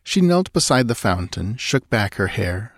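ordinary delivery, nothing marked

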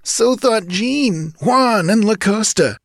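slow, high-pitched grim henchman; grim reply. A narrator's reading. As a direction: counter the chief grimly. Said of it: "answered the other grimly".

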